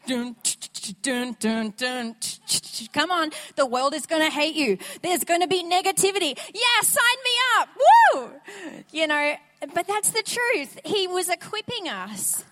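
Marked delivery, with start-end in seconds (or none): 0.1-2.9 mouth-made drum beat, dun and tch; beatbox the training montage riff. Retold or imitated. Eye of the Tiger sort of music. 2.9-8.3 building to a loud, high whoop; hyped rally cry. Retold or imitated. Hyped-up coach rallying troops.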